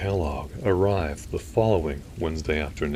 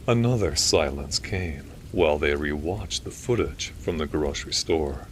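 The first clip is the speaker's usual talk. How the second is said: ordinary delivery, nothing marked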